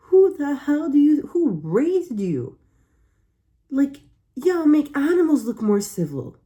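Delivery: high, loud outraged yell; outraged shout. Real, not acted. Yelling at the woman who skipped out on the bill, outraged. Ranting at the date.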